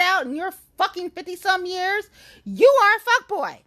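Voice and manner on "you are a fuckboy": high, loud and fed up; loud, high furious jab; angry call-out. Real, not acted. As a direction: hurl it at him. Angry rant at D.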